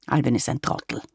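gruff low grumble; grumbled. A narrator's reading. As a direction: grumble it under his breath. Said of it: "sagte Opa Sepp gebrummt".

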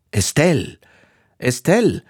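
restrained storyteller's shout after someone; mild narrated shout. A narrator's reading. Husband calls after his wife swimming away, mildly shouting. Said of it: "schrie Monsieur Chabre".

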